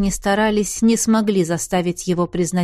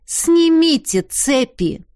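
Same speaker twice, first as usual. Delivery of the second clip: slow, loud and firm on Снимите; firm repeat; 7 semitones higher, 3 dB louder, slower than usual. Acted. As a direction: hammer Снимите slowly and firmly. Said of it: "твёрдо повторила она".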